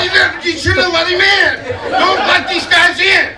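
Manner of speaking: high, excited mock scolding; worked-up scolding. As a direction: chew him out, wound up. Scolding the driver ahead.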